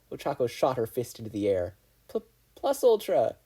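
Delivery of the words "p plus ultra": high, hesitant stammered cheer; weak echo. A narrator's reading. Izuku weakly echoing Ochako's battle cry. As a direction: join in timidly, stumbling. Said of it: "Izuku weakly raised his robot fist".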